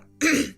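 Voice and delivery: abrupt, loud throat clearing; covering throat clear. Acted. Empress nearly admits using the child, covering throat clear. Stops before saying "use the child.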